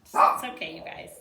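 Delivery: usual speaking voice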